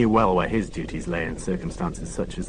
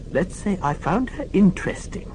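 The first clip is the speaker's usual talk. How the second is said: put-on a deaf old nurse correcting the inspector; prim old lady; 10 semitones higher than usual. Old lady scolding Morse for shouting.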